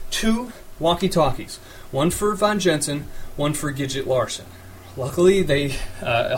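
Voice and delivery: ordinary delivery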